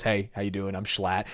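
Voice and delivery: low, subdued dad-like greeting; Schlatt. Retold or imitated. Schlatt greeting the storyteller from the car, low and understated. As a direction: drop low and soft for an offhand introduction.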